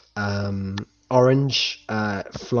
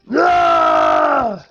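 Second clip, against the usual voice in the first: giant's roar; 10 dB louder than usual. Acted. Giant roars.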